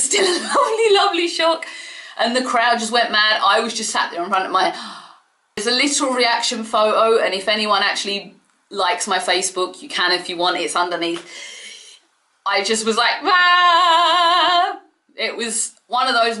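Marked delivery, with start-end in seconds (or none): 0.0-1.7 laughing. Real, not acted. Laughing at the memory. 13.2-14.8 loud, high sung-out scream; excited scream. Retold or imitated. Fan screaming at the TV when Sting appears. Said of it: "screamed in front of the T V".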